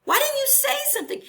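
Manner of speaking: why didn't you tell me, said high and quick; pleading demand. Retold or imitated. Client confronting her therapist, high-pitched and fast.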